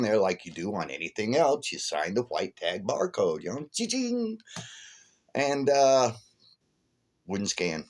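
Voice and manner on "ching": high little ching beep; register ching. Retold or imitated. Telling about self-checkout, a high mock register ching. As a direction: chime it like the scanner.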